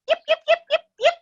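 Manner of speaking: wolf pup yipping at the noise; puppy yips. Acted. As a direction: yip like an excited wolf pup.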